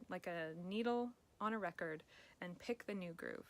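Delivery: ordinary talk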